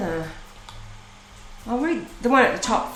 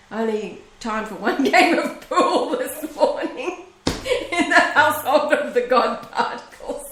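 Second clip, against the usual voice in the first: chuckling through the words, pitched a bit up; laughing; 4 semitones higher than usual. Real, not acted. Greeting viewers after a hectic morning, laughing. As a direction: laugh it out lightly while greeting.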